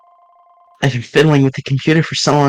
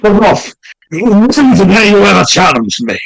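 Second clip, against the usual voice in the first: loud, higher villain shouting; angry shout; 5 semitones higher, 5 dB louder than usual. Acted. Exposed impostor yelling at the accuser, furious. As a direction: bark it back in a rage.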